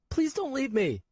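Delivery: put-on high, slow boy's voice near tears; tearful child. Nico dropped off alone, high tearful child. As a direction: hold back tears as a scared boy. Frightened boy.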